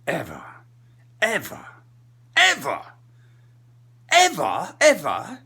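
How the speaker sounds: each ever a separate outburst, building theatrically; Richard Burton. Acted. Comic hamming up an actor's warning, building to a shout. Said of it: "go into slow Richard Burton".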